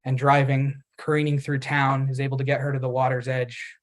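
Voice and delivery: ordinary talk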